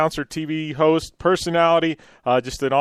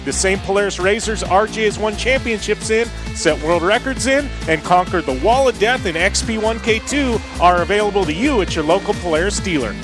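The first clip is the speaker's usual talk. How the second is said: ad read; 3 semitones higher than usual. Acted. Reads a sponsor ad.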